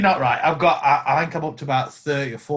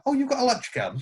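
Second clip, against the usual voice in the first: surprised fan; 10 semitones higher, faster than usual. Retold or imitated. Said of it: "people are going".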